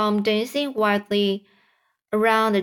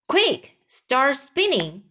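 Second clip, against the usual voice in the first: higher, slower mild yell; a raised-pitch order to hurry; urgent yell; 5 semitones higher, slower than usual. Acted. James ordering the silkworm to spin, mildly yelled. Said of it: "yelled James".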